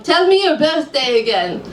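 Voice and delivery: put-on lightly Hebrew-accented psychic, businesslike. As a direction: ask calmly, Hebrew accent. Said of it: "I've never done a Hebrew accent".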